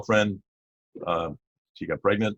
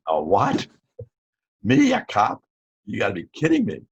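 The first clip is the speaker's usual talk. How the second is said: disbelieving, almost laughing it off; incredulous surprise; 3 semitones higher, 5 dB louder than usual. Retold or imitated. Stepfather offers him a cop job, he answers in loud disbelief. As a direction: voice jumps high and loud on "Me, a cop".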